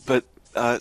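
normal talking voice

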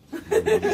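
quick squeal of laughter, very high; sudden high laugh. Real, not acted. Magician counting cards breaks into a quick high laugh. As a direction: burst into a short high laugh.

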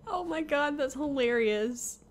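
ordinary delivery

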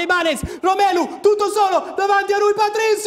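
rising excitement. Real, not acted. Chance on goal building.

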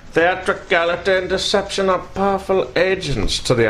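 high, lilting put-on villain voice; theatrical villain quote. Host quoting a villain speech to co-host, theatrical voice. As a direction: play a grandiose movie villain.

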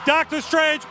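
usual speaking voice

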